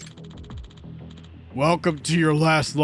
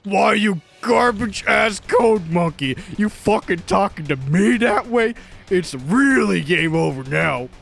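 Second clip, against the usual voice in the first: slow, louder menacing snarl; explosive rage; 4 dB louder than usual. Acted. Producer threatening the developer who insulted him, slow and furious. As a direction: drag each word out as a threat.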